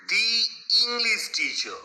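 slow teaching read of "দি ইংলিশ"; the before a vowel. Shown as a demonstration. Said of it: "ইংলিশ ই সাউন্ডটা আসছে".

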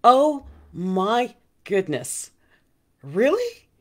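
slow, then rising high on really; retelling her own amazement, drawn out; surprised. Retold or imitated. Host retelling her reaction to her assistant, surprised. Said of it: "And I went".

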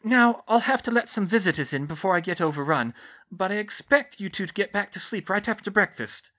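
high, loud matron laying down rules; Madame Pomfrey's character voice. Acted. Nurse ordering patients back to sleep after breakfast, high character voice.

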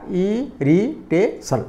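"Irritation" softly counted out as ଇ-ରି-ଟେ-ସନ୍; syllable by syllable. Shown as a demonstration. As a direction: separate the four syllables of "irritation", slowly. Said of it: "four syllabic word".

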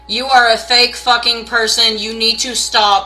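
loud, furious telling-off; angry rant. Acted. Tarot reader shouting down a troll commenter, angry and loud. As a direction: chew out the fake account, loud and fed up.

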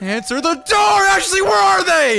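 impatient high-pitched shout; worked up. Acted. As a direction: yell at the closed door in a jealous fit. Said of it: "get this worked up over Emma".

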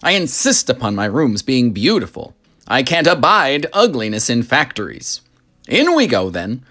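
high, lively showman voice; proud factory owner, bright and grand; eccentric chocolatier. Acted. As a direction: sweep the children along with delight. Wonka voice held.

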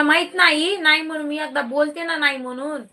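sharp, high scolding burst that settles. Real, not acted. Mother scolding her child mid-stream, sharply.

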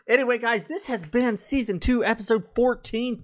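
high, loud host sign-off; upbeat announcer voice; podcast outro announcer. Acted. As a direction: announce the episode like a show host.

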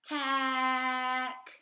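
teacher modelling sounds slowly; stretched sounds. Shown as a demonstration. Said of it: "say a word very slowly so you can hear each sound".

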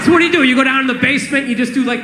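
ordinary talk

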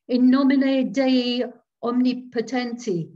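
a loud, high Latin cry from afar; cried out. A narrator's reading. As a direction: call out the prayer loud and high. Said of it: "it cried".

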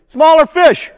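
loud, high call-and-response answer. Shown as a demonstration. Presenter calling out the answer to the kids, loud and high. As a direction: lead the kids, belting it out high. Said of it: "Everybody say".

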